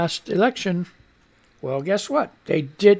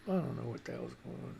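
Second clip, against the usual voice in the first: mumbled aside; 8 semitones lower, 19 dB quieter, faster than usual. Real, not acted. Aside about hair falling out.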